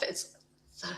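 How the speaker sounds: ordinary delivery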